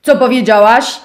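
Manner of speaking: głośne, groźne warknięcie. Acted. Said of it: "warknął". (szczur warczy na owieczkę, głośniej)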